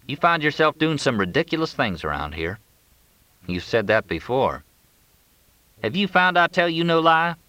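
folksy man confiding, lightly played; drawling ranch hand, uneasy. A narrator's reading.

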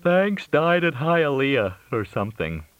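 words stretched through a big yawn; acted yawn swallowing the words. A narrator's reading. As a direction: talk through a yawn, high and slow. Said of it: "Fletch yawned again".

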